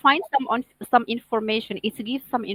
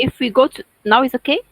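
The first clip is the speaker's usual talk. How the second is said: much louder and clearer now; speaking up; 10 dB louder than usual. Real, not acted. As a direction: raise the voice so all can hear. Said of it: "your voice is very low".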